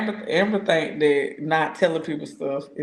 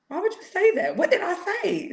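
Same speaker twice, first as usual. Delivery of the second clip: very high-pitched mock outrage; mock scolding. Telling off a friend for what she said, mock scolding. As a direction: squeal it out in comic disbelief.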